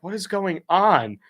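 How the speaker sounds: drawn-out confusion; bewildered. Retold or imitated. Sitting confused in truck.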